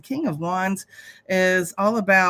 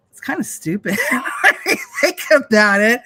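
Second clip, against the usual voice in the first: higher and louder, breaking into a laugh; 5 semitones higher, 6 dB louder than usual. Real, not acted. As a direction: laugh at how silly it is. Laughs at money's value.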